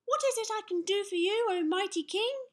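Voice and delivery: put-on high, loud, quick child's voice; eager little knight. A narrator's reading. Six-year-old knight offers help to the king, crying out eagerly. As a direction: pipe up high and keen. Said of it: "he cried".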